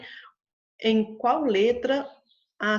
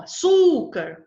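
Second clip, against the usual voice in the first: açúcar with the second syllable cú said loud and slow; stress shown on açúcar; 8 semitones higher, 8 dB louder, slower than usual. Shown as a demonstration. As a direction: lean hard on cú in açúcar. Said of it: "terminou em R, então, e o som".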